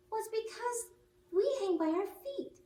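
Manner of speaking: squeaky bat, matter-of-fact; high little bat explaining. Acted.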